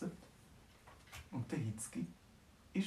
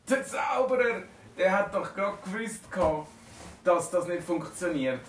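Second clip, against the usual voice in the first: higher and louder, lightly teasing; mocking dragons; 7 semitones higher, 6 dB louder than usual. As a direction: dragons laughing at the wizard's trick. Laughing dragons mock wizard. (neckend, höher und lauter)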